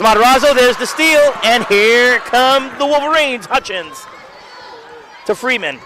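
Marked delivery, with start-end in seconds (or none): 0.0-3.5 louder and higher on the steal; rising fast-break excitement; excited call. Real, not acted.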